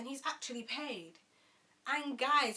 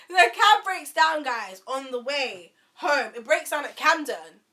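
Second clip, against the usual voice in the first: loud, higher, fed-up telling; loud exasperation; 4 semitones higher, 15 dB louder, slower than usual. Real, not acted. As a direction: keep shouting the bad news. Retelling the breakdown, still loud.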